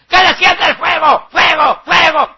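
loud, high imitation of fervent preachers; mock church shouting. Prosperity churches calling down fire, mimicked high shout. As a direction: yell it in parody of revival fervour. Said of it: "Gritan y gritan y gritan".

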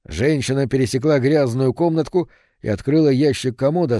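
ordinary delivery, nothing marked

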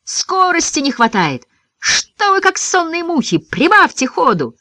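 high, drawn-out urging call; impatient cry. A narrator's reading. Johnny scolding sleepy runners to speed up, impatient high cry. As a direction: chivvy the slow runners along in a high voice. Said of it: "выкрикнул Джонни".

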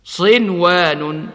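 صنوان with the nun clearly sounded; clear nun before waw. Shown as a demonstration. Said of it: "هكذا". (إظهار النون في صنوان)

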